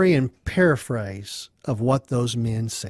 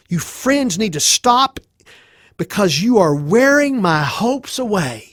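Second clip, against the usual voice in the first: higher-pitched, forceful complaint; exasperated outburst; 5 semitones higher, 4 dB louder than usual. Acted. Job telling his friends to stop, exasperated. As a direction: plead angrily to be left alone.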